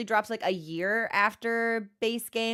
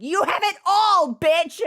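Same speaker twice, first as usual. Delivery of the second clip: higher, louder playful exclamation; mock envious shout; 8 semitones higher, 6 dB louder, slower than usual. She teases wavy-haired friends with an envious yell. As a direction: shout it with teasing envy. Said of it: "And I'm just like".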